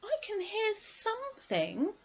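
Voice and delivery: put-on high-pitched boy at normal volume; boy's voice. A narrator's reading. Christopher Robin listening near the trap, high boyish voice.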